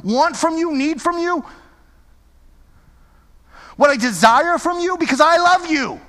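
God pleading with the congregation; voicing God. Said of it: "And he will say".

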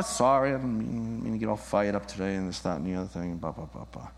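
low, soft mumble trailing away; sheepish mock confession. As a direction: mumble the apology down to nothing. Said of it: "the Hebet confession".

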